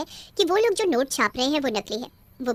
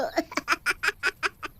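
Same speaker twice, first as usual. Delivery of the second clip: a witch's gloating evil cackle; high witch cackle; 4 semitones higher than usual. Acted. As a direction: laugh wickedly at them. Witch laughs at threat.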